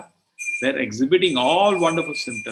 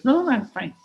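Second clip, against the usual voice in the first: high, bright-pitched humble refusal, a little louder; polite refusal; 12 semitones higher, 4 dB louder than usual. Retold or imitated. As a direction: wave off the offer kindly. Humble brahmin refusing money.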